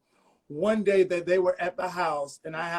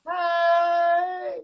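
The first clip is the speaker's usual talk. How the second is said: loud, high hey; excited greeting; 10 semitones higher, 5 dB louder than usual. Real, not acted. Guest greeting host's mother, excited high call. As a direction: hail them with delight.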